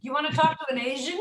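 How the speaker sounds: quick, laughing rising question; fast laughing mock query. Shown as a demonstration. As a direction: tease the mishearing while laughing.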